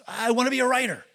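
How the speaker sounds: put-on high, loud little boy's answer; eager young boy. Preacher voicing a boy telling the man his dream, high and loud. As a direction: pipe up like an eager kid. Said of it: "He goes".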